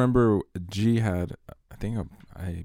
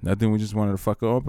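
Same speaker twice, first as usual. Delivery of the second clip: usual speaking voice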